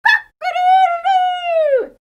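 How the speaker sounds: high, loud, slow cock-a-doodle-doo; rooster crow. A narrator's reading. The rooster crowing at dawn, loud high rooster call.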